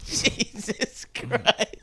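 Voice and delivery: high, soft, drawn out through laughter; helpless laughing. Real, not acted. Listener reacting to a dark joke, laughing. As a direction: squeeze it out while laughing.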